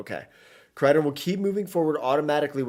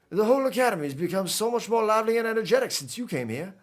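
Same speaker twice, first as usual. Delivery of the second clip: higher, lilting attempt at a Creole accent; animated Creole-accented character, pitched up; Cyrano accent; 7 semitones higher than usual. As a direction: voice a game character in a put-on New Orleans accent. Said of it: "pull out the Cyrano accent".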